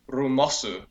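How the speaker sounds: Japanese-accented "rumosu", r for L; Japanese-style spell "rumosu". Shown as a demonstration. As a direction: say lumos as "rumosu" with a Japanese accent, added final u. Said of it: "they don't like having a final S either in Japanese".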